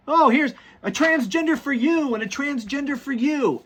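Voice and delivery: high-pitched mock handing out of party favors; mock gift-giver. Mocking post as party gift handout.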